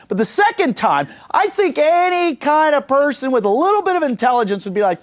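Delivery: usual speaking voice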